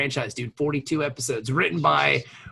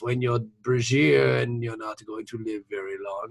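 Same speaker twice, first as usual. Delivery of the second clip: imitating a character, lower pitched; Bashir's regular voice; 6 semitones lower, 4 dB quieter than usual. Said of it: "he goes into his regular voice".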